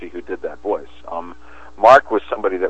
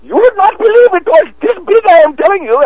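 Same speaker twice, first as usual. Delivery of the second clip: very high, much louder squeaky boast; fish-story voice; 10 dB louder than usual. Retold or imitated. Redoing his own game voice bragging about a fish, very high and loud. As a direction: squeal it out like a wild exaggerating fisherman. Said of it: "the voice that goes".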